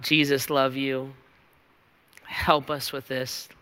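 low, soft closing prayer voice. Acted. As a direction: pray quietly over the band. Leads closing prayer over music.

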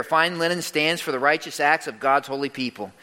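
ordinary talk

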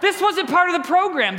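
put-on teen worker defending himself to angry parents, exasperated; exasperated retort. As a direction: fire it back in disbelief. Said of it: "I'm like".

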